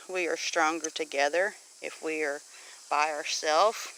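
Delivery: normal talking voice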